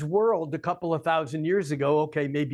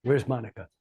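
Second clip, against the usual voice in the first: ordinary talk, nothing marked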